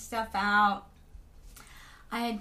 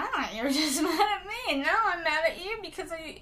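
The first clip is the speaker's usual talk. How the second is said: higher, whiny mock-upset with a laugh; mock hurt; 6 semitones higher than usual. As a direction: play up being wounded, half laughing. Acted hurt reply, turns mad.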